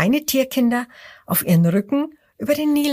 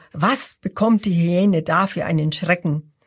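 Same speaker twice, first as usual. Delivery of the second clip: ordinary delivery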